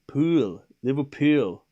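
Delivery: trying out a Scouse "Liverpool"; Scouse accent. Shown as a demonstration. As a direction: have a careful go at Scouse, working on "Pool". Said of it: "how do you say Liverpool in Scouse".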